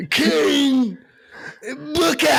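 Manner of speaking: hammed-up King Booker cry; King Booker call. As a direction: proclaim it like a pompous king. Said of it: "He used to go".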